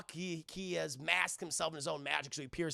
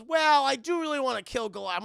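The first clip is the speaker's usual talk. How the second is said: eager admission; 7 semitones higher, 10 dB louder than usual. Retold or imitated. Demona admits her wish.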